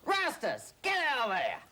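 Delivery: shouting at the kangaroo. Real, not acted. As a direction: shoo him out of the room. Shooing kangaroo from toilet.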